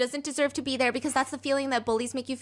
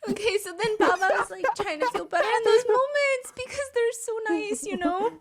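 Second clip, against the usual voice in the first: high squeaky voice after a laughing fit; real high, emotional squeal easing off; still choked-up; 11 semitones higher than usual. Real, not acted. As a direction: carry on while giggly and touched. Resuming while still laughed-up and moved.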